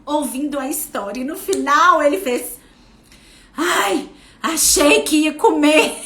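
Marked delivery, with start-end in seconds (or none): 3.6-6.0 put-on mild childlike relief, higher and louder; a hint of a relieved kid; imitating son. Mother retelling son's relief after the story, lightly voiced. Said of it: "Ele falou bem assim".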